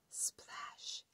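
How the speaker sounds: breathy whispered sound word; whispered splash. A narrator's reading. Sound effect word in story.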